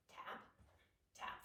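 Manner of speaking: normal talking voice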